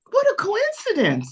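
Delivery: high-pitched imitation of amazement; retold surprise. Man meeting her at the foot of the staircase, imitated surprise.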